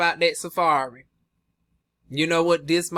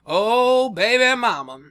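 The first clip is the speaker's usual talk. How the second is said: louder, higher sing-song cry; playful louder exclaim; excited exclaim; 5 semitones higher, 5 dB louder than usual. Real, not acted. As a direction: belt it out with glee.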